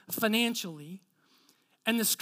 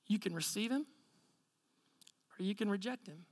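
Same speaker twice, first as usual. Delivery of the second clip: soft, low, measured gospel appeal; hushed, earnest pastoral counsel; solemn sincerity; 3 semitones lower, 8 dB quieter than usual. Retold or imitated. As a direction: lay it out gently and gravely, with long pauses. Gravely tells man the gospel.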